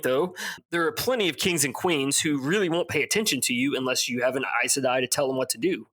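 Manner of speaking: normal talking voice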